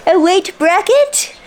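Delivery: a high, slow, drawn-out query; a playful self-questioning rise; mock surprised question. Real, not acted. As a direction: lift it high like a puzzled double take. Questioning her own word choice.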